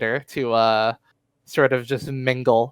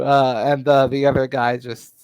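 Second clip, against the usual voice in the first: normal talking voice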